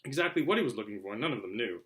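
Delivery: ordinary delivery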